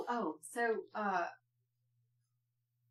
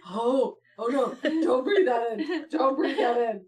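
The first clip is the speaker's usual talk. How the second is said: louder, urgent fretting; paranoid worry; 2 semitones higher, 7 dB louder than usual. Retold or imitated. Mom fretting over the blueberry, urgent and louder. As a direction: fuss anxiously over it. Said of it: "And she's like".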